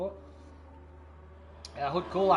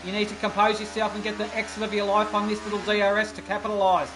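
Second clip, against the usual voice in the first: live race commentary; 4 semitones higher, 4 dB louder than usual. Acted.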